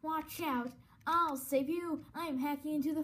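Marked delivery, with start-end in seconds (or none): none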